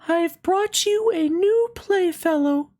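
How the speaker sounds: very high, gentle fairy voice. Acted. Fairy greeting the little rabbit, high and gentle. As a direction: sing it out light and high like a fairy. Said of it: "the fairy said".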